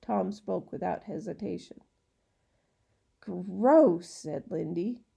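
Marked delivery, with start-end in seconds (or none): 3.2-4.1 disgusted. Acted. Child disgusted by python.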